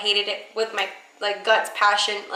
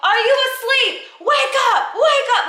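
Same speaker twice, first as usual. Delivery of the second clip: screaming boss; 11 semitones higher than usual. Retold or imitated. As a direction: scream at a worker to wake up. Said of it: "like, screaming".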